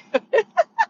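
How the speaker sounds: sudden squeaky laugh at her own joke; high laugh. Real, not acted. Laughing at herself after saying it's not me, a high squeal. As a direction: burst into a high giggle.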